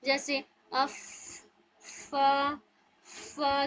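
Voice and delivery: अफ फा फा with a little air flowing out; fa demo. Shown as a demonstration. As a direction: let a little air escape on फा. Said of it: "थोड़ा सा air का बाहर flow होगा". (हवा निकालते हुए फा)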